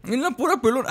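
very high, giddy exclamation; giddy high-pitched celebration; squealing delight. Real, not acted. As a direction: squeak it out through glee. Celebrates a lucky headshot.

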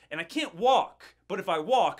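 venting at the front desk, fed up; exasperated. Retold or imitated. Listing every blocked option, exasperated.

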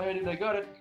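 normal talking voice

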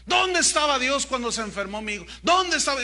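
loud, high, indignant demand; accusing question. Retold or imitated. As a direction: cry out the challenge to God. Voices people's typical accusing question.

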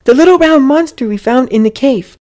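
put-on very high, loud child's voice; earnest little boy. A narrator's reading. Boy explains the creature to Mom, high earnest kid voice.

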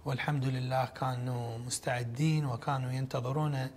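ordinary talk, nothing marked

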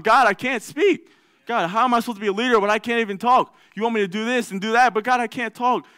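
desperate plea, pitched high; pleading Moses. Retold or imitated. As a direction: beg God, voice high and straining. Moses pleads he cannot speak.

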